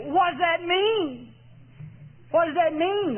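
high, drawn-out incredulous question; mock bafflement. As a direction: play clueless, stretching it out high. Mocks a puzzled reply from overboard.

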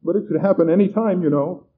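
high, nervous warning; jittery and insistent; stammering. A narrator's reading. Frightened man warning the host about his box, stammering. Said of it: "the man stammered".

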